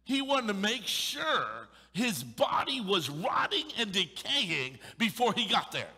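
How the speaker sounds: forceful preaching build; preaching emphasis. Real, not acted. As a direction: hammer home each word.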